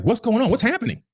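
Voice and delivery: high panicked questions; a wife panicking over her husband. Retold or imitated. Wife asks in a high panic. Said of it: "She's, like, panicking".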